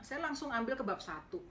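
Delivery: ordinary delivery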